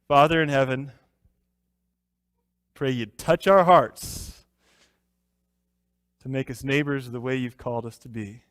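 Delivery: hushed, unhurried prayer voice. Acted. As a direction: pray gently before the study. Leads a closing prayer.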